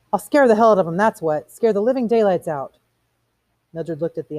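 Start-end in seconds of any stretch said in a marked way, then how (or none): 0.1-2.8 higher, forceful retort; defiant outburst. Acted. Montag arguing with Faber about the women, defiant. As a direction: snap back defiantly.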